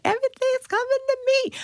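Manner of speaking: high, fast breezy inner voice; high airy voice of easy luck; mock carefree voice. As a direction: toss it off like it's all easy. Self-doubt inner voice retold.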